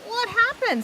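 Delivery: high, startled "what happened"; surprised question. A narrator's reading. Pa startled by news about Wilbur, high surprised. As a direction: ask it with a jolt of alarm.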